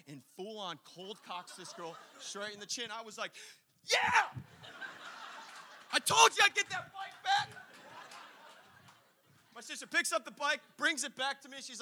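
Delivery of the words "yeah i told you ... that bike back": gleeful cheer. Retold or imitated. Cheering sister's punch.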